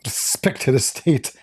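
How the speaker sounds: slow mocking whisper; whispered mockery. Mocks clip's wording.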